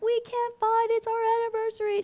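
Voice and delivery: high-pitched imitated tearful wail; put-on crying voice; mock crying. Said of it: "the wife started crying".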